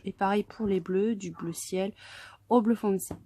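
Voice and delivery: ordinary delivery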